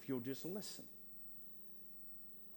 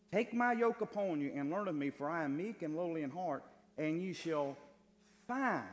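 loud, raised preaching voice; scripture proclamation; 5 semitones higher, 5 dB louder than usual. Acted. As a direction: declaim the verse forcefully, building to the last word. Proclaims the verse at force.

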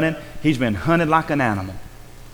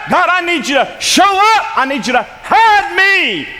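desperate plea; 6 semitones higher than usual. Acted. Preacher crying out as David, high and desperate. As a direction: cry out to God as a hunted man.

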